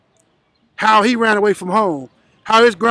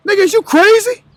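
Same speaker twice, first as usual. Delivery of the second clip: incredulous retort; 9 semitones higher than usual. Retold or imitated. A young gang member scoffing at the older man, incredulous. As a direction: throw it back like he's lost his mind.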